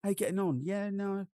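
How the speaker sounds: high-pitched, matey London slang accent; quick London geezer chat. Shown as a demonstration. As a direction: greet a buddy in London slang. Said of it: "my buddies in London".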